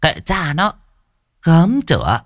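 sneering high dismissal; scornful. A narrator's reading. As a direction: toss it off with contempt. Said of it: "Mụ nguýt".

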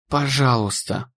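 soft desperate plea; pleading softly. A narrator's reading. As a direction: beg quietly under breath. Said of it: "молила она".

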